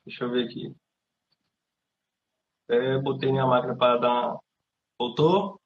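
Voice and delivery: glitchy robotized voice over a bad connection; broken-up digital robot voice; robotic voice. Real, not acted. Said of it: "sua voz tá robotizada".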